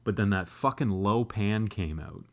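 ordinary talk

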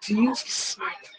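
high whining complaint; whiny complaint. Acted. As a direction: whine like a fed-up kid. Reclamando do dia de escola. (reclamação chorosa)

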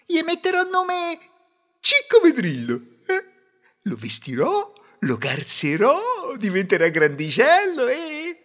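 put-on high-pitched voice of a young bride; bride daydreaming aloud. Bride imagining her future baby, high-voiced daydreaming.

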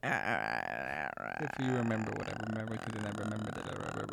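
low, creaky imitation; vocal fry impression. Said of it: "What the fuck was she talking about".

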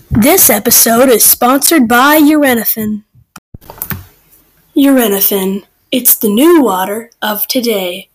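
fake ad read. Fake commercial at the end of the episode, ad read. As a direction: sell it like a radio spot.

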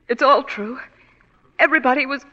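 rising distraught outburst; desperate confession. Acted. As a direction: let the panic build line by line.

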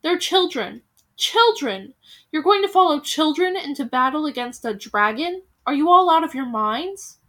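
loud, incredulous tirade; scornful outrage. Acted. Bald veteran mocking children as war leaders, loud outrage. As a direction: pile on the disbelief.

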